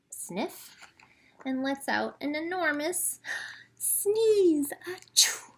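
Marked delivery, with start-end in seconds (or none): 3.7-5.5 high loud mock sneeze; storyteller acting a giant sneeze; big sneeze. A narrator's reading. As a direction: ham up a huge achoo. Lion lets out enormous sneeze.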